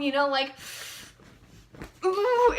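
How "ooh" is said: high, loud ooh of awe; a thrilled squeal of wonder; excited ooh. Acted. Kid remembering the fun of a broken bone: high excited ooh.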